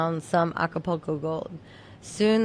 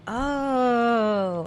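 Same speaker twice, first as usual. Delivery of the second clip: drawn-out, higher "oh" of realization; dawning realization; 5 semitones higher than usual. Retold or imitated. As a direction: let the penny drop on a long oh. Retelling sudden realization about mom's weed.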